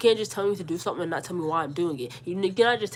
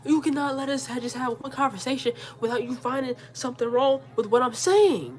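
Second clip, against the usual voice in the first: exasperated; 5 semitones higher than usual. Retold or imitated. Daughter fed up with mom's nitpicking, exasperated. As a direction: throw up a raised-voice complaint.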